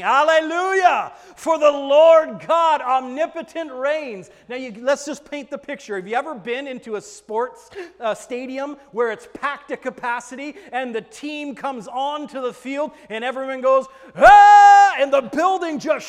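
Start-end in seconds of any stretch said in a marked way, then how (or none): 0.0-4.3 loud, slow, soaring hallelujah; triumphant shout. Acted. Reading multitude's praise cry. 14.2-15.0 a huge high stadium roar; crowd roar. Retold or imitated.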